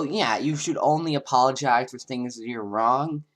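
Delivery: ordinary delivery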